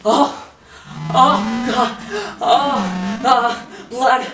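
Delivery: terrified, half-mad shrieking; panicked screaming. Acted. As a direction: scream in terror at the blood. Sudden bloody hallucination, panic.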